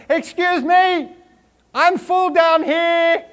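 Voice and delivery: very high, slow, squeaky little stomach; tiny polite stomach pleading, high and slow. Pretend. Stomach to its eater, tiny high slow voice. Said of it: "Our little stomach says".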